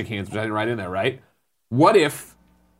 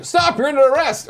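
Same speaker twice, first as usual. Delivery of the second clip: mock cop shout; 11 semitones higher, 3 dB louder than usual. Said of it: "is this when they're".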